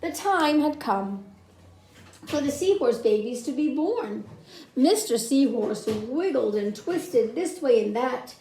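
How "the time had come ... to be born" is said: ordinary delivery, nothing marked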